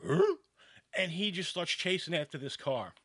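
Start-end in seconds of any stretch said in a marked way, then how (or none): 0.0-0.3 high, loud startled huh; be the dog jolted awake. Retold or imitated. Sleeping dog hit by the car, startled huh. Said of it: "he's like".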